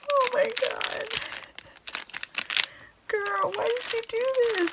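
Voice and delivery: high, fast gushing; delighted squeal. Real, not acted. Thanking the friend who sent it, high and gushing. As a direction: gush in disbelief at the gift. Gift unboxing excitement.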